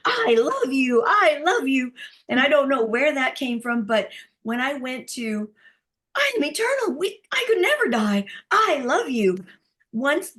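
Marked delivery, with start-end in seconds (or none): 0.1-1.9 loud, much higher crying shout of love; tearful yelling. Retold or imitated. Yelling love at people in the training, tearful shout. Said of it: "kept yelling at people". 6.2-9.4 very high, loud exalted cry; ecstatic proclamation. Retold or imitated. Proclaiming to the training group, ecstatic cry.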